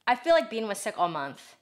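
normal talking voice

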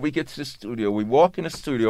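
usual speaking voice

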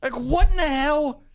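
high, slow incredulous complaint; exasperated. Retold or imitated. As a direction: whine at how annoying it is. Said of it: "I'm like".

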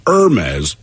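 Hermes drawn out as "ur-MEZ"; slow Hermes without H, ur-MEZ; variant pronunciation. Shown as a demonstration. Host reading listener comments aloud, Hermes as slow ur-MEZ. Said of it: "It's pronounced Hermes".

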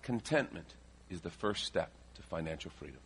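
measured, quiet key point; dictating for notes. Shown as a demonstration. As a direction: give it out in pieces for note-takers. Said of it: "write this down".

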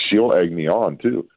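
ordinary delivery

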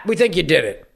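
ordinary talk, nothing marked

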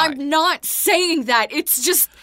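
loud, slow, pleading cry; loud pleading objection; upset protest. Acted. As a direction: insist over him, rising high. Cut off arguing with dad.

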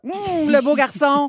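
mock-swooning girl receiving a photo; delighted girl. Imagined girl receiving a man's photo, mock delight. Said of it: "elle va faire".

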